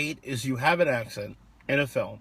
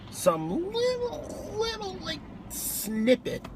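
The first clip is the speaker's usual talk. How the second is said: very high, drawn-out squeak; tiny squeaky voice; slower than usual. Real, not acted. Wishing for some reference to X-Men, tiny squeaky voice. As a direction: squeeze it small and high, slowly.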